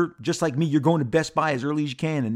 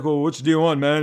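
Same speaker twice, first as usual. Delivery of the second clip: lightly voiced laid-back toy dealer, a touch louder; toy dealer impression; 5 dB louder than usual. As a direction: slip into the dealer's easygoing pitch, only a little put on. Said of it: "He talks like this".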